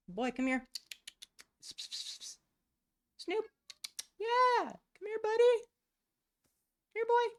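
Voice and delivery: coaxing her cat Snoop over, high pet-calling voice. Real, not acted.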